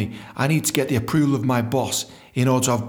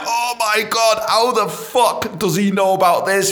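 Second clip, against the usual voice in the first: high, loud and slow panicked cry; high loud alarmed wail; inner panic; 9 semitones higher, 4 dB louder, slower than usual. Retold or imitated. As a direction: freak out inside, dragging the words. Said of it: "screaming inside thinking".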